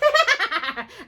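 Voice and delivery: high witch cackle; witch laughter. A narrator's reading. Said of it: "The sisters all laughed".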